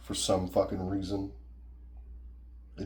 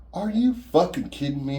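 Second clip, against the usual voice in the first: high, loud furious retort; angry outburst; 8 semitones higher, 6 dB louder than usual. Retold or imitated. Bully yelling back at the accusation, outraged. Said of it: "he was like".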